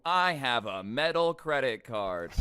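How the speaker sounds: slow, loud mock bragging; mock boastful. Narrator mocks customer bragging about his card, loud mock boast. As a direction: imitate a show-off, drawn out and loud.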